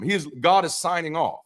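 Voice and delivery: usual speaking voice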